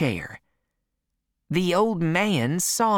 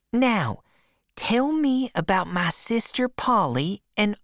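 put-on higher, fond old aunt; Aunt Sally's voice; 6 semitones higher than usual. A narrator's reading. Aunt welcoming her nephew at the door, higher and fond. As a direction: gush over the boy like a doting aunt.

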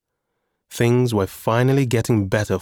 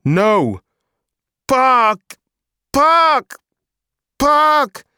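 loud, very high repeated wails; toddler crying tantrum; 6 dB louder, much slower than usual. A narrator's reading. Emma demands the park, wailing tantrum. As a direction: escalate into a shrieked toddler demand. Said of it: "she repeated in between cries".

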